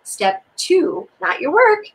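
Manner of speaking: ordinary talk, nothing marked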